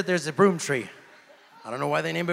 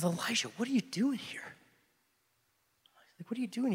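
higher and softer, nearly whispered; mild hushed God voice; 7 semitones higher, 5 dB quieter, faster than usual. Acted. Preacher voicing God gently questioning Elijah on the mountain, hushed. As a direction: ask it gently, like a quiet voice from above.

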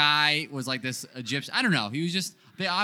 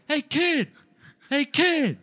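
high, loud hailing shout; retold yelling; 13 semitones higher, 6 dB louder, slower than usual. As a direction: holler after someone walking away. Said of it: "Nobody yells at somebody like that".

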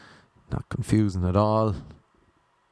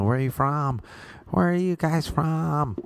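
imitated drunk girl; 6 semitones higher than usual. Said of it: "this drunk girl was in the back".

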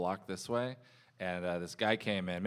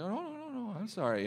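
high, soft, fussing mock apology; overly polite apology; 11 semitones higher, 6 dB quieter than usual. Customer out-apologising waiter, high mock-polite protest. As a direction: imitate someone rushing to take the blame. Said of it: "the nicest, weirdest guys".

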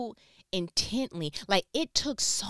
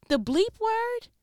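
much higher, slow mock gasp; shocked disbelief; 14 semitones higher, 4 dB louder, slower than usual. Real, not acted. Reacting in mock shock to the coworker's swearing. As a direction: hit it like you can't believe it.

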